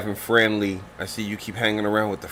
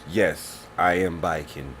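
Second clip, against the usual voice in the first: lower, gruff curmudgeon; curmudgeon character voice; 5 semitones lower, 8 dB quieter, slower than usual. Acted. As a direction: play the grumpy old warrior. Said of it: "So she goes".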